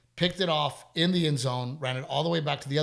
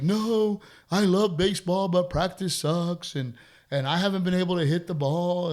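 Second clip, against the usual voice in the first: put-on higher, louder kid voice insisting; son whining; 4 semitones higher, 5 dB louder than usual. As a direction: play the boy pleading he loves baseball. Dad voicing son's complaint.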